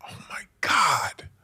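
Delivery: slow, tearful whispered gasp of realisation; choked-up whisper. Real, not acted. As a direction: barely get it out, near tears. Moment of revelation, choked up.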